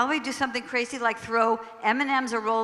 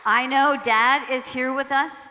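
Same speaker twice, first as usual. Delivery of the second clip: usual speaking voice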